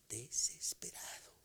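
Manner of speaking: slow voiceless whisper. Real, not acted. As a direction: breathe the word out in a whisper. Preacher whispers his own despair.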